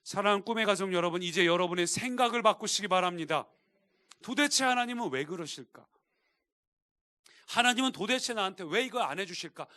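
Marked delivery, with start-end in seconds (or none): 4.2-9.7 raised, louder acted grumble at God; blaming complaint. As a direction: play a believer lodging a grievance. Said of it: "하나님 탓을 하는". (하나님을 탓하듯 목소리 높여 하는 원망)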